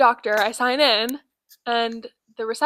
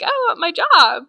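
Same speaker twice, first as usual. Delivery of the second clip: higher, louder, slower sweet reply; mock innocent; 7 semitones higher, 8 dB louder than usual. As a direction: answer in a high, sugary voice. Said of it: "And I was like".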